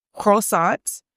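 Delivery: croissant tried with a French accent, kroh-SAHN; a shaky stab at a French accent on Croissant. Shown as a demonstration. Trying out a French accent for viewers, hesitant French accent. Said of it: "I don't know how to use my French accent".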